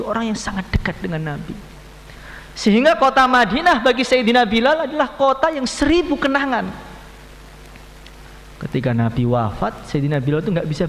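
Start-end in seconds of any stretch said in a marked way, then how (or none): 2.6-6.7 high, loud preaching swell; rousing sermon peak; impassioned preaching. Acted. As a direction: let the memory swell up. Preacher builds emotion about Madinah.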